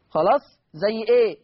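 ordinary delivery

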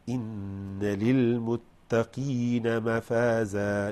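slow, drawn-out tajweed chant; Quran recitation. Shown as a demonstration. Teacher chanting the verse for students, slow tajweed. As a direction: recite it slowly, melodic.

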